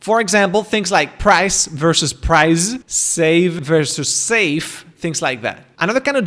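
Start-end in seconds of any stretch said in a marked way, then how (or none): 1.2-2.8 slow "price versus prize", sharp s in "price", long buzzing z in "prize"; "prize" stretched with a voiced z, "price" ending in s; minimal pair contrast. Shown as a demonstration. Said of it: "distinguishing between sounds that change meaning". 2.9-4.8 very slow "save versus safe", voiced v against hissed f; deliberate pause, then "safe" with a clear final f; minimal pair contrast. Shown as a demonstration. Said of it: "distinguishing between sounds that change meaning".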